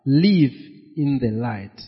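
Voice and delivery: ordinary delivery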